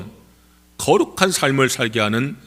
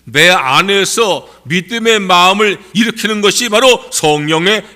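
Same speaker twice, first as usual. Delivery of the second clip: raised, ringing pulpit voice, pitched high; impassioned preaching; 6 semitones higher, 5 dB louder than usual. Real, not acted. Preacher urging congregation to believe the Spirit's work, impassioned preaching. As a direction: preach it louder and higher, driving each phrase home.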